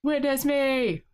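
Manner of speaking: put-on high, strained war boy catchphrase; war boy cry. Fan quotes the Mad Max war boys' catchphrase, high war cry.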